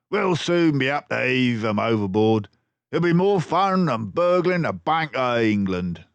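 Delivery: loud crook voice; gloating robber. Acted. Bank robber boasting to his gang. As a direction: relish the cruelty.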